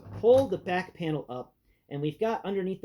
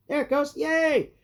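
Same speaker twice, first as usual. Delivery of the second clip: excited; 8 semitones higher, 4 dB louder, slower than usual. Real, not acted. Part finally clicks in.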